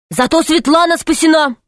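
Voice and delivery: high-pitched, insistent retort; boy's defiant defence. A narrator's reading. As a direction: protest back in a high voice. Boy defending what he did. (мальчишка горячо возражает, высоко)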